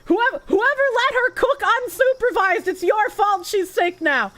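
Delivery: hammed-up tearful accusation; mock whiny blame. Streamer blames chat for Peeba's sickness, mock crying.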